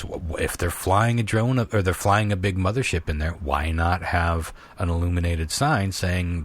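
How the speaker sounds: ordinary talk, nothing marked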